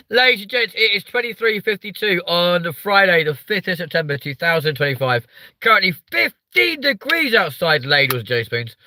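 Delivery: high, loud announcement to the audience; big, high-pitched mock bulletin; mock announcer. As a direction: proclaim it like a hammy announcer. Mock announcement of time and weather.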